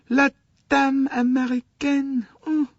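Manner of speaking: high, frantic French conductor's cry; distraught conductor. Acted. Conductor despairing to Poirot about the American lady. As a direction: wail it out like a harried Frenchman.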